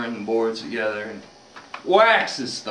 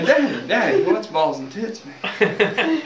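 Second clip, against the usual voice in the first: higher-pitched kid question, lightly voiced; little boy; 7 semitones higher, slower than usual. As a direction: pipe up like a curious small boy. Boy asks father about curse.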